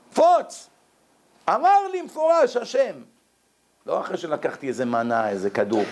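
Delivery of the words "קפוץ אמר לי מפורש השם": high, punchy exclamation; emphatic insistence. Acted. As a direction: act out an emphatic, raised-voice command. Acting out a divine command to jump.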